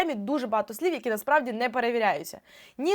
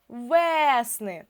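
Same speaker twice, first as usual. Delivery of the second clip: ВЕСНИ with a long stressed Е; наголос на Е. Shown as a demonstration. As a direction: stretch the first vowel of ВЕСНИ. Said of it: "перевіряти слово наголосом". (ВЕСНИ з протяжним наголошеним Е)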